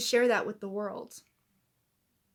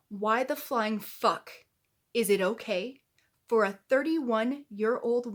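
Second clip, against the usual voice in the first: furious demand; 4 dB louder than usual. Real, not acted. Vlogger calling out a bully online, furious and loud. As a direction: demand an answer you know won't come.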